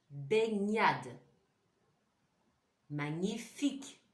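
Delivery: "Gna", "Gni", "Gnon" then "Mignon" and "Chignon", each with a clear gn, gaps to repeat; repeat after me. Shown as a demonstration. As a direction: say each one for the class to echo. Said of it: "Vous répétez après moi".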